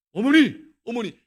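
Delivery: calling out. Retold or imitated. Son excitedly calling his mother, loud.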